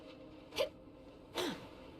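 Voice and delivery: a sudden breathless gasp of shock; sharp gasp. Real, not acted. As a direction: gasp in alarm, no words. Sudden in-game mishap.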